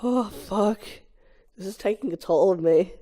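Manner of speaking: usual speaking voice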